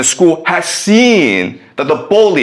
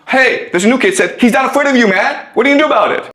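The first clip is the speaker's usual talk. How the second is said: higher-pitched, urgent retelling; agitated report; 8 semitones higher, much faster than usual. Acted. Bully's friend goading his boss, agitated. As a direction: egg him on, pitch climbing.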